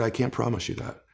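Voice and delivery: soft voice with a catch of tears; father's tearful promise. Retold or imitated. Retelling his sober father's answer, softly choked up. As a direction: hold back the crying as you promise. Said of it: "And he said".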